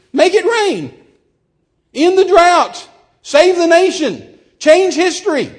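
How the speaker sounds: high, loud rallying cries; shouted commands. Retold or imitated. Guest telling preachers how to fix it, shouted commands. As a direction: shout each order with a gap after it.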